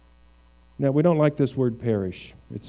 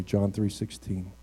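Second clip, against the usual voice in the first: ordinary delivery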